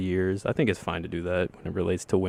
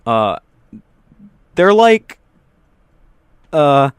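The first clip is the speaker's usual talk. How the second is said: excited; 7 semitones higher, 9 dB louder than usual. Real, not acted. Loud searching for words.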